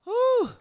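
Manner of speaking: sharp high exclaimed whew; loud high whew. Real, not acted. Reaction to demon snapping.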